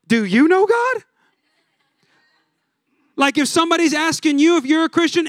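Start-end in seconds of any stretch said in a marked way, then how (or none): 0.1-1.0 puzzled echo. Retold or imitated. Stranger echoing the question back in mock confusion. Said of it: "they looked at you and they go".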